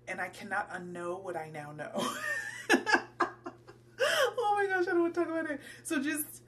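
shrieking laugh, high and loud, half disgusted; horrified laughter. Real, not acted. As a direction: laugh it off in real horror. Grossed out by bathroom particles.

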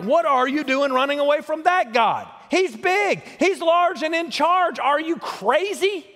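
sailors scolding a runaway, hammed up; incredulous sailors. Sailors scolding Jonah for fleeing God, in high mock disbelief. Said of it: "And they're like".

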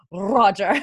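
a hint of a Scottish accent on "Roger", imitating Jamie; Jamie's Scottish Roger. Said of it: "he goes".

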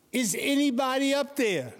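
high, pleading call from a man dangling off a cliff; high frightened shout upward; desperate cry upward. Acted. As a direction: call up to the sky for help. Man hanging from cliff calls out.

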